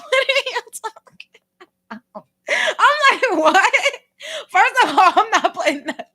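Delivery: high, laughing disbelief; laughing hard. Real, not acted. Vlogger cracking up at coworker's theory, laughing. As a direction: break up with laughter.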